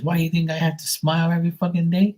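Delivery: high, fast indignant mom impression; imitated mom's irritated rant; mad mom. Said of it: "why she's mad".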